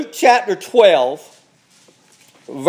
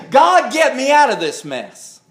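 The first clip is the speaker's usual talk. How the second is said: higher-pitched plea to God; acted urgent plea, pitched up; pleading prayer; 6 semitones higher than usual. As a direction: cry out to God for rescue. Acts out a plea for rescue.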